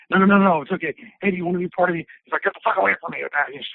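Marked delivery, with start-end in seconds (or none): 0.1-2.1 higher, hurried production-crew patter; lightly acted eager persuading; pushy coaxing. Production crew pleading in retold scene. 2.4-3.2 higher-pitched mock snap; mildly hammed-up "back off" tone; retold angry brush-off. Retold angry refusal to crew.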